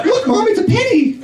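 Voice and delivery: put-on very high excited child; kid voice. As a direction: squeal like a little kid. Child spotting him on sidewalk.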